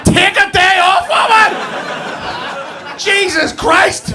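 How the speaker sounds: high, drawn-out exasperated shout; outraged yell. Retold or imitated. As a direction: bellow it slowly in mock outrage. Outraged comeback to wife's joke.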